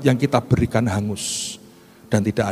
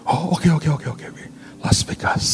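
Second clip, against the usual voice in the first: surprised officer; 4 semitones higher than usual. Retold or imitated. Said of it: "Kaget orangnya".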